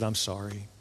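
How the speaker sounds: low, very soft apology; hushed apology. Retold or imitated. Sonographer breaking news of the lost baby, hushed apology. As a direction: break bad news very quietly.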